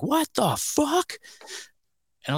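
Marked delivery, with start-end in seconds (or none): 0.0-1.1 much higher and slow, drawn-out disbelief; stunned disbelief. Retold or imitated. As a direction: stretch the words out, high and stunned.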